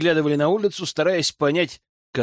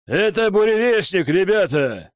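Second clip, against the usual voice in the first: shout; 4 semitones higher than usual. Acted. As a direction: shout it to your men. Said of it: "выкрикнул Фиданца".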